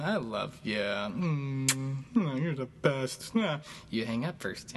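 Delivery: high, giggly, lovey-dovey phone goodbye; giggly late night call. Pretend. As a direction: play a smitten lover who won't hang up. Playful lovers' phone goodbye.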